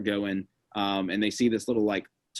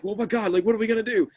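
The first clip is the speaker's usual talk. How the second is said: high-pitched, panicky retelling; mock panic; 9 semitones higher, faster than usual. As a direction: act out a group starting to panic. Acting out the panicked group.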